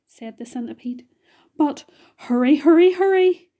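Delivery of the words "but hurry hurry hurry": loud, rising urgency; urgent hurrying. A narrator's reading. Centipede urging the boy to speak, loud and urgent. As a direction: push them on, higher and louder with each word.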